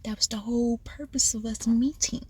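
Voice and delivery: whispered, calm and measured; calm whispered reply to the friend. Retold or imitated. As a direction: hush it down as if keeping calm. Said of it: "So I calmly text her back".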